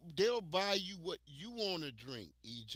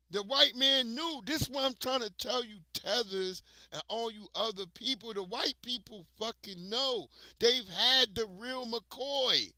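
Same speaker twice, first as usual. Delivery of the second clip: rising, louder and higher, hammering the point; loud fired-up rant; emphatic loud rant; 7 semitones higher, 8 dB louder than usual. Real, not acted. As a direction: preach it to the room, building. Building rant to audience.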